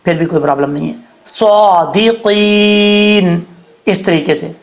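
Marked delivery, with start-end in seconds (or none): 1.2-3.4 صادقین with a heavy saad and a hiss of whistle; slow heavy saad demo; full-mouth whistling saad. Shown as a demonstration. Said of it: "اڑھائی الف کے برابر".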